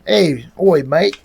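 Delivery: mock Cockney street greeting; London accent. Troll hailing someone in London, mock London accent. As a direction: put on a rough-and-ready London accent.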